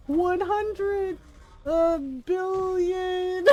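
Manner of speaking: milk the dramatic pause like Doctor Evil. Retold or imitated. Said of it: "he's just like".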